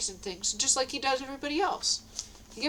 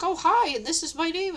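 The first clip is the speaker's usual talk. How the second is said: happy greeting; 7 semitones higher, 5 dB louder than usual. Retold or imitated. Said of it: "she's real happy".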